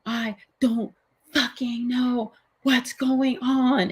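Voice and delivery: slow, breaking voice rising high on the curse; retold despair of a cop who broke down crying; imitating a crying cop. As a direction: mimic a cop falling apart in tears. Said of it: "broke down, started crying".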